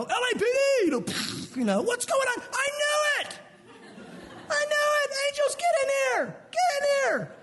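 very high, shouted raid-cop voice; mock police raid. Jesus as a cop kicking the door down, high mock shouting. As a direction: bust in yelling like a cop.

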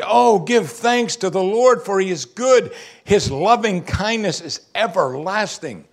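OH GIVE THANKS proclaimed high and slow; high, ringing, drawn-out praise; emphatic reading. Shown as a demonstration. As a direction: read it out as a heartfelt exclamation. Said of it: "that's his point".

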